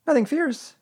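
very high, anxious little voice; tiny worried creature asking; Piglet. A narrator's reading. Piglet checking with Pooh for danger, anxious.

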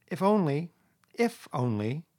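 doubtful, starting high then easing; dubious. A narrator's reading. Rat eyeing Toad's repentance, dubious. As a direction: leave the doubt hanging. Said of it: "observed the rat dubiously".

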